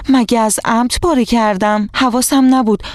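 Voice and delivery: put-on indignant child's defence; offended. A narrator's reading. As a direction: protest that it wasn't on purpose. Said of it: "روزا دلخور گفت".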